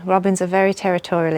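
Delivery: ordinary talk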